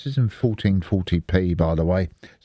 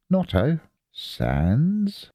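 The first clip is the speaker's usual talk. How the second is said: slow spelled-out; 7 semitones higher, much slower than usual. Shown as a demonstration. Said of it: "watch this".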